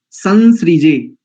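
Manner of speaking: संसृजे slowly with a nasal सं, not सम; drawn out संसृजे; संसृजे with सं like in संसार; correct pronunciation. Shown as a demonstration. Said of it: "सम नहीं करेंगे".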